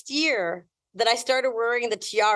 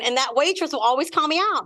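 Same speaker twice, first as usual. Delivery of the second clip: usual speaking voice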